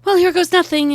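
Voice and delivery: loud, high burst of nerve; bracing herself. Acted. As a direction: psych yourself up out loud. Character steels herself at door.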